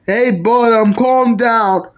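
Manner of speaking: ordinary delivery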